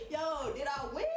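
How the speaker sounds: asking again through laughter; laughing repeat. Real, not acted. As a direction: say it still laughing.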